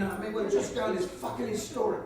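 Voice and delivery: ordinary talk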